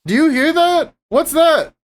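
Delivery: high shouted call at a bear; scaring off a bear. Acted. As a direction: yell it out to spook the bear. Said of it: "yell at the bear".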